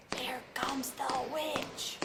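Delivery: slow, hushed whisper. Acted. Kids hear footsteps and whisper fearfully. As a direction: breathe it like scared kids. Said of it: "they whispered".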